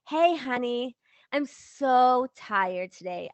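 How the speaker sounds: drawn-out, emphatic hint to a spouse; hammed-up tired plea, slow on the complaint; acted weary appeal. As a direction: lay the tiredness on for a partner. Acted phone call to husband.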